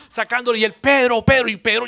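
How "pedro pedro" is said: urgent call. Retold or imitated. Juan urgently calling Pedro.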